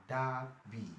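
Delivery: "Derby" drawn out as "daah-bee"; long A. Shown as a demonstration. Teacher demonstrates the long vowel, slow "DAAH-bee". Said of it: "Derby is a long R".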